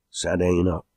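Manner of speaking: ordinary delivery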